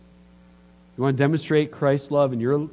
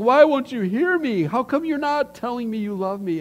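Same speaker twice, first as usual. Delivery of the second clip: high, mock-exasperated complaint; hammed-up spouse's frustration; frustrated plea; 9 semitones higher than usual. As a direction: plead to be heard, pitched high. Acted frustrated spouse not being heard.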